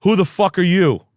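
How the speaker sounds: high, loud tough guy challenge; mock angry yell. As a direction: bark it like a hostile framer.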